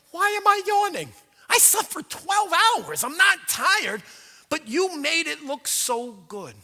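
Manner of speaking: hammed-up confusion at yawning; mock baffled self. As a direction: act baffled at your own yawn. Retelling his own yawning puzzlement.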